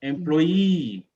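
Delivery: "Employee" as em-ploy-EE, long falling end; stress on last syllable. Shown as a demonstration. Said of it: "The last one".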